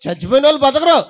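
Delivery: rising from a brisk question to a loud declaration; Jesus rebuking mourners. Retold or imitated. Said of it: "ఆయన అన్నాడు".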